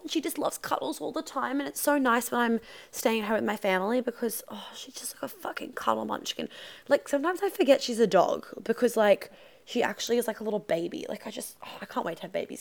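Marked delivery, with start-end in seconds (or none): 1.6-6.5 whispered. Real, not acted. Dog cuddled beside her.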